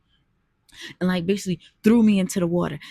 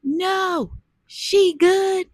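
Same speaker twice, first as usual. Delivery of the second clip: high, louder dismissive reply; boyfriend waving them off; 12 semitones higher, 6 dB louder, much slower than usual. Retold or imitated. Boyfriend tells the boaters she is fine, high and louder. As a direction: brush the strangers off. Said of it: "He tells them".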